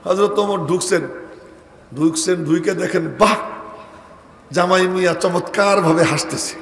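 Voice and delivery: ordinary talk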